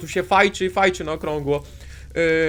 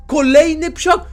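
much higher, louder yelp of surprise; startled exclamation; 10 semitones higher, 9 dB louder, slower than usual. Real, not acted. Gamer spotting another monster dog, startled yelp. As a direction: blurt it out as it jumps into view.

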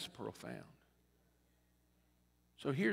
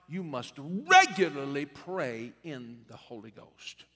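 ordinary talk

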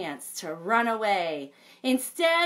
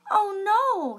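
high, loud oh no; a squeaky shocked outburst; alarmed papa; 13 semitones higher, 6 dB louder than usual. Acted. Papa reacting to the eaten carrots, high and loud.

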